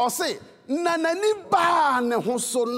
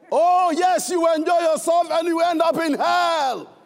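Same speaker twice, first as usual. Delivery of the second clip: raised, high-pitched preacher's warning; loud scolding retort; 3 semitones higher, 4 dB louder than usual. Retold or imitated. Preacher answering the friend who sang to him, loud scolding. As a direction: throw it back at them, loud and high.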